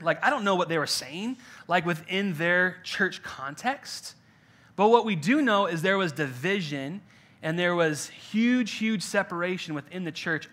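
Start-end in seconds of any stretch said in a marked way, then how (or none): none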